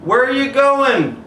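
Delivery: high slow call after him. Acted. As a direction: yell after a running boy. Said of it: "his dad yelled after him".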